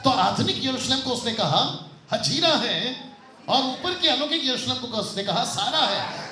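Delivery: ordinary talk, nothing marked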